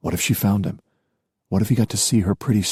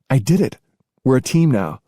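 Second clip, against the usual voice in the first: higher voiced happy cheer; excited; 7 semitones higher than usual. Gia cheers, hugging him.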